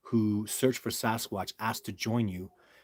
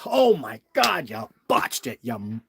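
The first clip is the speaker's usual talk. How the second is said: upset outburst, imitated; 5 dB louder than usual. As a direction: act out a colleague's dismayed outburst. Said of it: "like, he's upset".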